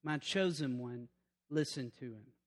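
a quiet, solemn voice from heaven; voice of God. Retold or imitated. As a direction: speak it as God from the cloud, low and hushed. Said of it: "a voice came out of the cloud saying".

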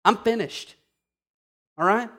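ordinary talk, nothing marked

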